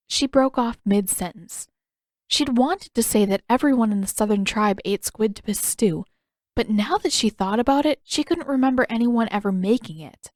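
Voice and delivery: plain reading voice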